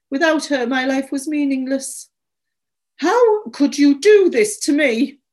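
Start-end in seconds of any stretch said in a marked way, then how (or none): 3.0-5.2 higher, louder, wounded outburst; anguished accusation. A narrator's reading. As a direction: fling it at them as an accusation. Distraught owner confronts the boys.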